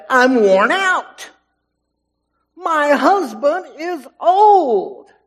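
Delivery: loud, high, drawn-out protest; incredulous Sarah. Acted. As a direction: protest slowly at full voice, pitched well up. Voicing weary, disbelieving Sarah.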